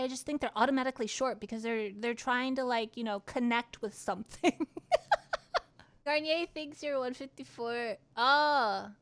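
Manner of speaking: usual speaking voice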